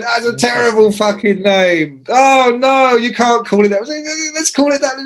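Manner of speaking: high outraged mock chorus; mock colleagues' outcry. Colleagues rejecting the magazine name, high mock outrage. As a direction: squeal it like appalled colleagues. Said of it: "Everybody went".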